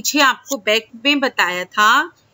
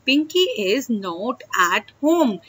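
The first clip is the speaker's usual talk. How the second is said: slow demo; much slower than usual. Shown as a demonstration. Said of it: "English में conversation करेंगे तो क्या बोलेंगे".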